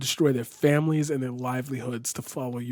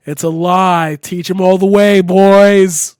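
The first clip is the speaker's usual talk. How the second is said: higher, loud rallying yell; loud cheering shout; 5 semitones higher, 16 dB louder, slower than usual. Real, not acted. Cheering the Walking Dead group.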